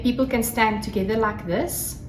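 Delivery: ordinary talk, nothing marked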